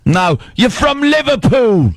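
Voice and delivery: slightly hammed-up English accent, pitched high; mock English accent. Co-host assigning the intern an English hometown, mock English accent. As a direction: correct him in a put-on English accent.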